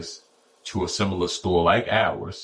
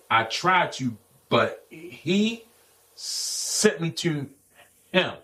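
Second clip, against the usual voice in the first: stuttering impression; 3 semitones higher, much slower than usual. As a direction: stretch and stall the words like Earl. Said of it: "This is how he talking".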